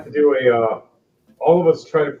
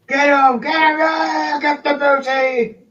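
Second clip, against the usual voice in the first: much higher, loud rowdy pirate yells; pirate mob shouting; 7 dB louder, slower than usual. Acted. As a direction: cheer the attack like a greedy pirate crew. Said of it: "pirates and undead creatures going".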